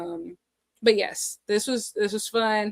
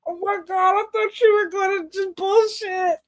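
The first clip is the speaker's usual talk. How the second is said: overwhelmed surprise; 10 semitones higher than usual. Real, not acted. Host stunned that her guest gave a real compliment, overwhelmed. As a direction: wail it, floored by the kindness. Moved by unexpected compliment, near tears.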